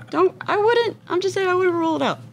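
high, fast squeaky protest; squeaky joking voice. Pretend. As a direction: pipe up defensively, high and quick. Joking survival defense.

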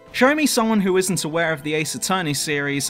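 ordinary talk

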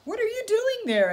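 high, loud curious coyote voice. Acted. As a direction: call up to him, pitched high and loud. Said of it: "asked Coyote".

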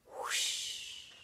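breathy airy whoosh; soft airy whoosh sound; whispered whoosh. A narrator's reading. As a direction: blow it out like rushing water. Whispered effect.

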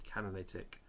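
ordinary talk, nothing marked

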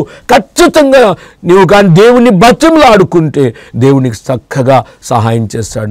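normal talking voice

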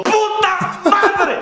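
high, drawn-out frustrated yell; pretend yell. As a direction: scream it out at the world in frustration. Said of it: "quieres gritarle al pinche mundo". (grito de frustración, agudo y alargado)

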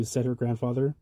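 usual speaking voice

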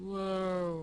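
amazed wow. Real, not acted. Sudden sight in game.

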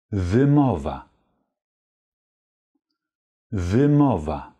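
wymowa drawn out slowly, syllable by syllable; slow model wymowa. Shown as a demonstration. Teacher slowly repeats wymowa for German learners. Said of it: "Die Aussprache lautet auf Polnisch".

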